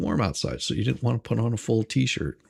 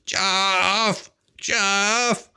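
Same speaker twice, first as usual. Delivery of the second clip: mock nagging wife; 7 semitones higher than usual. Wife voiced nagging husband Jeff.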